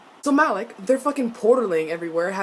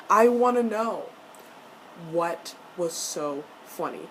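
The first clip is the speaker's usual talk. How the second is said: deliberate stern demand; 3 semitones lower than usual. Pretend. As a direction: spell it out with mock-stern restraint. Same demand, slowed for effect.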